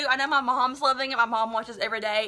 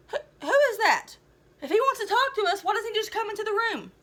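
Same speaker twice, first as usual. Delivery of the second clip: puzzled Cap; 10 semitones higher than usual. Acted.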